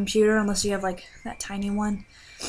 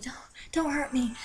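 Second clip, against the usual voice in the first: whispered, frightened plea; scared whisper; 4 semitones higher, 8 dB quieter than usual. Retold or imitated. Beagle whispers a scared plea to the dreamer. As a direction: beg like a cowering pup. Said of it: "He's looking at me like".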